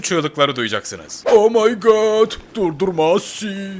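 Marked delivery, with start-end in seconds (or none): 1.3-3.7 high, loud, drawn-out terrified cry; panicked Ronaldo scream. Pretend. Ronaldo on the surfboard pleading with Messi, terrified slow shriek. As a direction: shriek it, begging Messi to stop. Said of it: "Muhtemelen böyle diyecektir".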